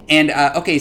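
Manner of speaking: ordinary talk, nothing marked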